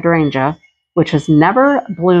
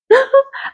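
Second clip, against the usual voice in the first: short, high amused laugh; a bright giggle at the chaos. Real, not acted. Laughing at cows running in the field.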